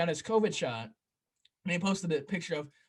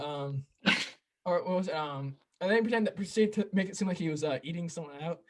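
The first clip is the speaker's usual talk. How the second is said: ordinary talk, nothing marked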